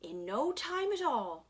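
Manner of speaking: plain reading voice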